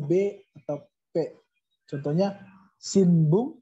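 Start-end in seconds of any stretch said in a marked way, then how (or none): none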